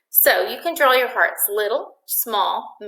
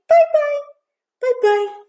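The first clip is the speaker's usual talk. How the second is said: very high little cooing goodbye; 4 dB louder, slower than usual. Real, not acted. Owner saying bye-bye with her puppy, high baby talk.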